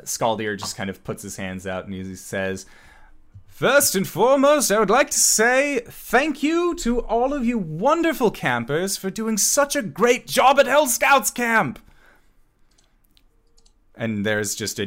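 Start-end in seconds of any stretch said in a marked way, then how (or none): 3.5-11.8 high, loud announcer pitch to a crowd; showman camp manager, rousing. Acted. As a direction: whip up the campers in a big high voice. Camp manager addresses campers.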